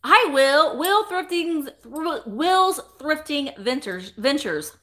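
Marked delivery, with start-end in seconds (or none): none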